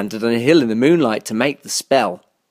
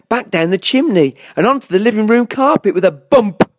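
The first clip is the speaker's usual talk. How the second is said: usual speaking voice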